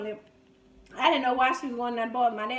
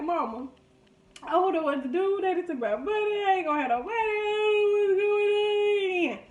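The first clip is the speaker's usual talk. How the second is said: crying to mama; 6 semitones higher than usual. Retold or imitated. Crying to mama over lost pay.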